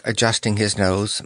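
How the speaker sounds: usual speaking voice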